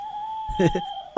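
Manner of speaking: high, soft scheming giggle; sly laugh. Acted. Ramu secretly laughs at the thieves, sly high giggle. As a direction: giggle at the trap you set.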